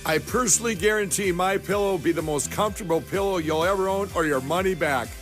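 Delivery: normal talking voice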